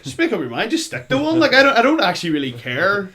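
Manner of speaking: loud, rising frustrated plea; mock exasperation at the actor; exasperated outburst. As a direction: throw up your hands and shout it. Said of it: "you're just like".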